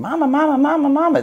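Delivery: put-on high, loud childish calling of mama; a kid tugging at a parent's sleeve, whining mama over and over; little kid nagging. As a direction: call for mama like an insistent small child. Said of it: "like a little kid going".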